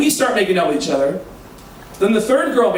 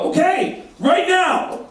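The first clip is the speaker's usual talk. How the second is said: mocking; 9 semitones higher, slower than usual. Mocking Jay's order.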